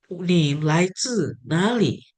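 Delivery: slow model Mandarin question; example question. Shown as a demonstration. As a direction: spell it out word by word for learners. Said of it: "ada contoh kedua".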